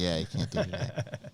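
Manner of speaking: mock scolding. Friend mock-scolding the others about problematic jokes. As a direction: scold them in a mock stern tone.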